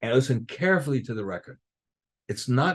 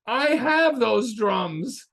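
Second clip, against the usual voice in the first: slow amazed reveal; 8 semitones higher, much slower than usual. Retold or imitated. Realizing he owns rare drums.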